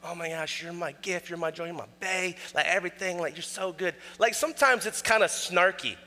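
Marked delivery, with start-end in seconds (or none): 0.0-3.9 much softer, sugary doting voice; mock sweet wife. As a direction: gush it, tongue in cheek. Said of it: "It's not always gentle".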